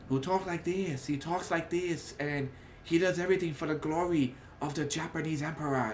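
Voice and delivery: put-on Japanese villain voice, measured and ceremonious; villain's voice. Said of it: "that's exactly what he sounds like".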